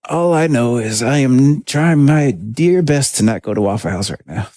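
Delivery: usual speaking voice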